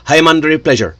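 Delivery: rushed name in a lightly imitated Brazilian accent; poor Brazilian accent. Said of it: "not a very good Brazilian accent".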